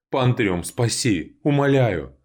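high, loud desperate plea; desperate pleading. Acted. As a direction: beg for rescue while sinking. Sinking in mud, begging.

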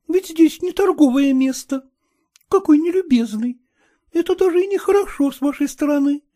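high, playful scolding; coquettish young woman. A narrator's reading. Agniya coaxing Kutsin, high and playful. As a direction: tease him into giving way.

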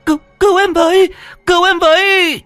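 high, shrill screams for help; panicked scream. Acted. Hằng crawls to the gate, screaming for help. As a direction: scream for your life. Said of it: "hét lên hoảng loạn".

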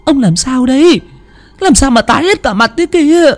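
put-on high, anxious old woman's voice; worried old wife. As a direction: fret over her pale husband. Wife asks husband worriedly. (giọng bà lão lo lắng, cao giọng)

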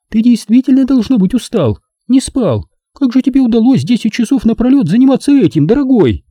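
high-pitched woman, mock sympathy; Nina, a sarcastic woman. A narrator's reading. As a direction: needle him in a high feminine voice. (высокий женский голос, язвительное сочувствие)

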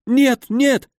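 high-pitched, pained wailing; panicked injured baron. A narrator's reading. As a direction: plead in high, agonised bewilderment. (высокий страдальческий голос перепуганного барона)

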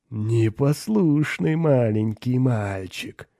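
slow, cooing demon voice; demon baby-talk. A narrator's reading. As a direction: lisp it mockingly like baby-talk, drawn out. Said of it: "просюсюкал Борбас". (демонически сюсюкая)

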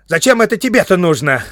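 high, loud burst of suspicion; shrill, vexed outburst; sudden cry. A narrator's reading. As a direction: cry out sharply, pitch leaping up. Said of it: "Вдруг вскрикнул он, и голос его странно изменился".

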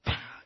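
short vocal "pah" for a lash; imitated whip crack.

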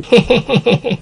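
high, falling staccato laugh; villain's gloating cackle. Acted. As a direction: cackle high with lecherous glee. Villain gloats over captive.

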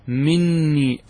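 "مني" said with a held, doubled n; doubled noon. Shown as a demonstration. Teacher repeating the form for students, "مني" with doubled n. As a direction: linger on the merged n of "مني". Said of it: "هذا إذا أثبتت النون".

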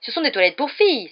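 loud, high, scandalised cry; shocked exclamation. A narrator's reading. Percy sees Ron leaving the girls' toilet, exclaiming. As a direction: exclaim in outrage. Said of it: "s'exclama-t-il".

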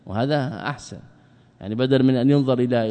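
ordinary talk

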